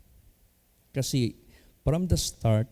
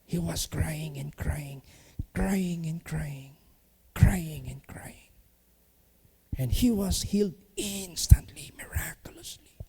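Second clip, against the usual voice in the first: slow, soft whisper, drawn out; hushed whisper; 5 semitones higher, 5 dB quieter than usual. Real, not acted. Pastor recounting the weeping man's healing, whispered slowly. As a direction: whisper the miracle so the room leans in.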